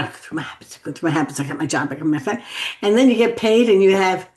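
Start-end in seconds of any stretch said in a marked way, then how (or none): 0.0-2.4 low, hushed, hurried rattle; autopilot muttering. Acted. Worker going through habits without thinking, mock autopilot mutter. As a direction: rattle it off under the breath, low and quickening.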